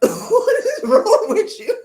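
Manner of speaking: high, slow laughing exclaim; laughing disbelief. Real, not acted. Coach laughing at a client who could quit, amused disbelief.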